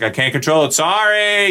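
hammed-up yell, sliding up high on the last word; half-shouted, high-pitched mock apology. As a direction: play a guy who can't control his volume. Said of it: "guy who yells a lot because he can't control the volume of his voice".

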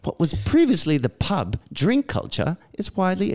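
hammed-up British reading, sing-song swoops; British accent. Co-host reading Englishman's recovery email, hammed-up British accent. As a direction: read it like a theatrical Brit. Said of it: "read it in a British accent".

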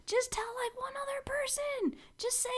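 high pleading whisper. Acted. Begging listeners to share.

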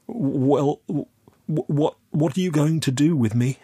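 high, halting fearful voice; frightened stammer. Acted. Youth asks captor his fate, frightened stammer. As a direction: struggle to get the question out.

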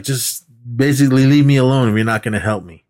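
ordinary talk

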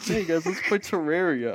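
high, loud mock-excited pitch to friends; rally your friends with exaggerated enthusiasm; acted-out keen shout; eager invitation. Acts out inviting friends to play.

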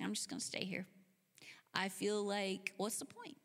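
soft, given-up murmur; dejected. Acted. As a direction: say it like there's no use getting up. Despondent Mary stays sitting.

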